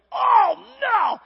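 loud, high panicked cry of oh no; panicked shout. Retold or imitated. Boy seeing the cornfield catch fire, panicked shout. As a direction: yell it as the fire spreads. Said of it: "I was like".

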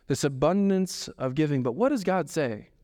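normal talking voice

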